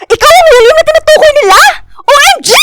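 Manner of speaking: high, fast astonished cry; shocked gasp. Acted. Friend realises which William it is, high shocked cry. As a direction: blurt it out in disbelief.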